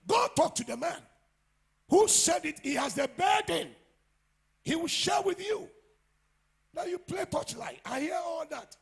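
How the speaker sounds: high, loud rebuking shout; scolding shout. Real, not acted. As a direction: call them out at full volume. Pastor scolding congregation loudly.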